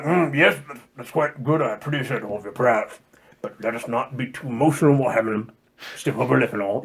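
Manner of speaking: mild posh British calm, holding back emotion; stodgy British Jesus. Retold or imitated. Pastor voicing the stiff translation's Jesus, mild British accent. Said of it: "the stodgy British Jesus".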